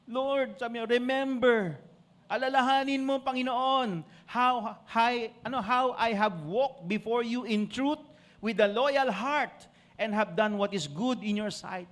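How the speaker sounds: high, loud pleading prayer of a sick king. Retold or imitated. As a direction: cry out to the Lord, begging to be remembered. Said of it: "Sabi niya, at nanalangin". (mataas at malakas na pagsusumamo ng may sakit na hari)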